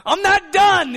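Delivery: high, loud, pleading cry of protest; mock kicking and screaming. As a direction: beg God in a hammed-up panic not to take you. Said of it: "kicking and screaming".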